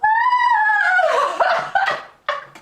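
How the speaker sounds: very high, loud sustained wail; piercing held top note; high sung note. Real, not acted. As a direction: hit a whistle-high note and crack up. Ends her song on high note.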